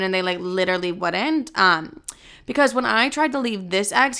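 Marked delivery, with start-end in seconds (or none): none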